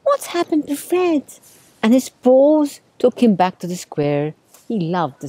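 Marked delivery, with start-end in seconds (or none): 0.0-1.2 high-pitched wondering cat voice; high cat voice for a storybook; questioning cat. A narrator's reading. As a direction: ask it high, missing a friend. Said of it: "often he said".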